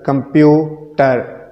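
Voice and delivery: "कंप्यूटर" slowly as कंप्यू, pause, टर; syllable by syllable. Shown as a demonstration. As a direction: hold back the last syllable of "कंप्यूटर". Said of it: "ये वो तरीका है".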